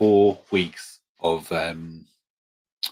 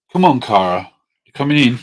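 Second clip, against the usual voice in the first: higher, louder coaxing call to the dog; pet-talk call, raised and bright; 5 semitones higher, 7 dB louder than usual. Real, not acted. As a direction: call her in from the doorway. Calling her pet inside.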